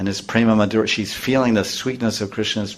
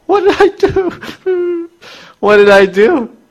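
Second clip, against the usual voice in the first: much higher, slow, wide-eyed plea; innocent bewilderment; 5 dB louder, slower than usual. Retold or imitated. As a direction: play the baffled boy who did nothing wrong. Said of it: "Krishna said".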